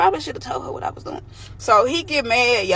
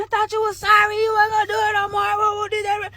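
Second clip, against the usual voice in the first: higher, wailing plea, a retold sob; half-acted tearful protest, pitched up; retelling how she cried at her boyfriend, high and tearful; mock crying plea; 9 semitones higher than usual. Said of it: "I'm hysterically crying".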